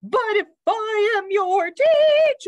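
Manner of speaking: high, loud sing-song bird; interrupting bird teacher. A narrator's reading. Bird interrupts the confused dog, high and insistent. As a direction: cut in with a high, trilling voice. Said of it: "the bird interrupted".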